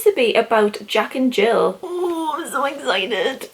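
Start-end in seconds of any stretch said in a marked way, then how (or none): 1.8-3.5 high, breathy squeal of joy; excited squeal. Real, not acted. Opening a friend's present, squealing with excitement. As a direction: gush over the gift.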